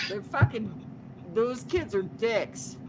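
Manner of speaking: normal talking voice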